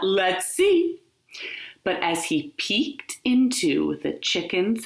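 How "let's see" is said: wolf gloating to himself; gleeful wolf. A narrator's reading. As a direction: gloat over the fat chicken. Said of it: "he thought".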